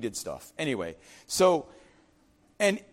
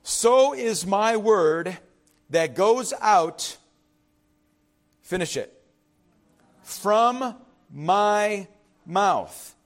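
slow scripture reading; 4 dB louder, much slower than usual. Shown as a demonstration. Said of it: "It says".

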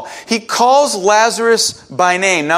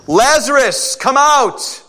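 raised, higher call across to the tomb; loud call; 6 semitones higher, slower than usual. A narrator's reading. Jesus calling dead Lazarus out of the tomb, raised call. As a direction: call him out with a lifted voice. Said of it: "Jesus called out in a loud voice".

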